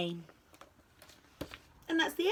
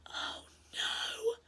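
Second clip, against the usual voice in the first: high, hushed, trembling dread; frightened whisper; 13 semitones higher, 7 dB quieter than usual. A narrator's reading. Friends realise who must be knocking, frightened whisper. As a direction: whisper it in horror. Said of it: "they all whispered".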